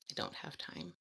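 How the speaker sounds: low whispered aside; whispering. Real, not acted. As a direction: breathe it out with no voice. Quiet aside after translation.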